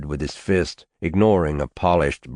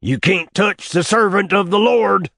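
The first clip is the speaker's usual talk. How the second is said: hollered much higher and louder; 14 semitones higher, 5 dB louder than usual. Acted. Old man hollering defiance at his nephew, loud holler. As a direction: bellow it like a prophet. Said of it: "the old man hollered".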